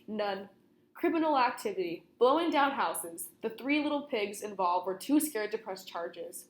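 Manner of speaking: plain reading voice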